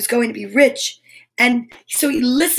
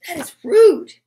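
high, louder, affronted exclamation; mock indignation; 7 semitones higher, 5 dB louder than usual. Reader commenting on the goblins' behaviour, mock indignation. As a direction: sound scandalised on the children's behalf.

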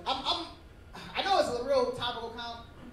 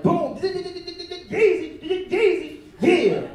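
stretched high, loud [name]; mock [name] entrance; 8 semitones higher, 7 dB louder, much slower than usual. [name] walks into a room, hammed-up loud [name] call. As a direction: burst in announcing yourself.

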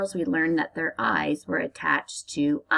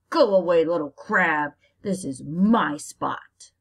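louder, slow, bossy crab voice; bossy big crab; 5 dB louder than usual. Acted. Big crab telling the little crab to leave, bossy. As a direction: push the little one off your spot.

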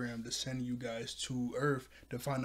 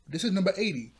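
louder, higher and proud; excited announcement; 6 semitones higher, 7 dB louder than usual. Real, not acted. Vlogger tells viewers this is episode eighty, loud and excited. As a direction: announce it like a big win.

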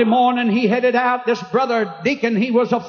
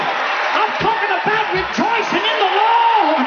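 preaching at full force; 7 semitones higher than usual. Real, not acted. Preacher calls the congregation to rejoice anywhere, full-force shout. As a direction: preach it to the rafters.

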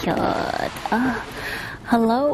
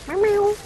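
playful meow; 11 semitones higher than usual. Pretend. Meowing at cats.

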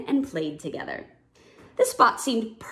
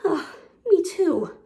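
winded agreement; 8 semitones higher, slower than usual. A narrator's reading.

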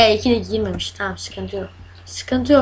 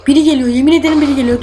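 panicked; 4 dB louder, much faster than usual. Real, not acted. Hears someone coming, panics.

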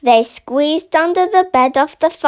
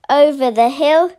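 plain reading voice

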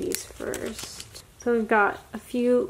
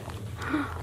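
breathy gasp; 3 semitones higher, 9 dB quieter than usual. Real, not acted. Sees exciting card.

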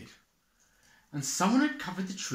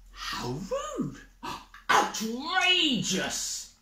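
much higher, slow, drawn-out huff; outraged; 11 semitones higher, much slower than usual. Acted. Animals huff indignantly at Badger's rudeness. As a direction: sound scandalised.

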